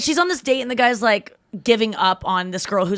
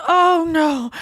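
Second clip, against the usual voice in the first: mock dismay; 8 semitones higher, 4 dB louder than usual. Retold dismay at being found out.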